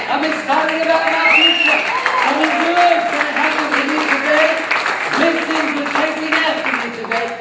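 loud, high, rising fervor; rally the congregation with joy; triumphant sermon climax; preaching at full force. Acted.

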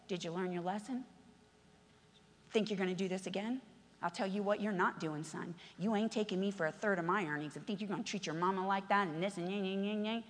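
a hint of a tender father talking to his son; mild quiet father voice. Father gently speaking to his returning son, softly.

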